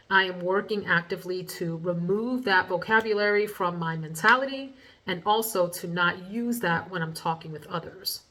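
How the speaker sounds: ordinary talk, nothing marked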